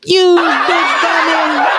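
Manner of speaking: squealing with laughter; laughing high mock scold. Real, not acted. Mocking the criminal, laughing.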